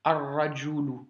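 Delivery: Ar-rajulu, r doublé, lam muet; Ar-rajulu sans prononcer le L; liaison solaire. Shown as a demonstration. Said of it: "On va donc faire la liaison". (prononciation arabe modèle)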